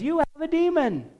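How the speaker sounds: high, taunting crowd voice; mocking crowd. Crowd accusing Jesus, quoted.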